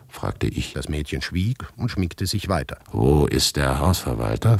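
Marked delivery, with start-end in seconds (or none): 2.9-4.6 changed, slower voice, a touch lower; other voice. A narrator's reading. Narrator asks the girl again in another voice, changed. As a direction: ask it again in a different voice. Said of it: "fragte ich mit anderer Stimme".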